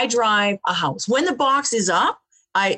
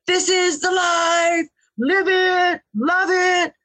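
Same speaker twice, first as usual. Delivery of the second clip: happy shout; 9 semitones higher, much slower than usual. Retold or imitated. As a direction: belt it out like a happy drifter. Toothless Joe, all happy, shouting.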